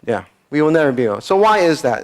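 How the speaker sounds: ordinary delivery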